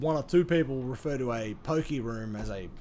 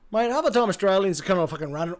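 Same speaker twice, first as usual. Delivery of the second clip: normal talking voice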